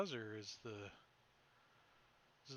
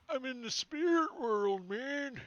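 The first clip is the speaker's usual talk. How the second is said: dazed spirit-world voice; 11 semitones higher, 7 dB louder than usual. Acted. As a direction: sound tripped out and dreamy. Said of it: "He's like".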